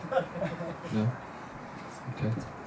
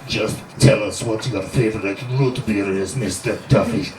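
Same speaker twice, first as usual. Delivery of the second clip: cool foreign spy menace; 4 semitones lower than usual. Acted. Interrogator questioning the prisoner.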